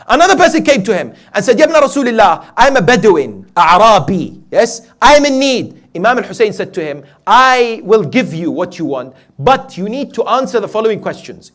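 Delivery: high, loud sermon storytelling; full-force preaching. Real, not acted. Preacher tells of a Bedouin asking Hussein, full-force preaching. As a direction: carry the tale forward with ringing force.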